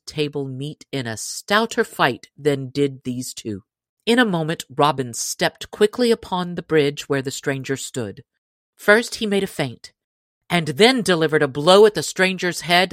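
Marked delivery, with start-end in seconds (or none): none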